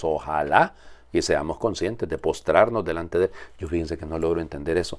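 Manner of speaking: ordinary talk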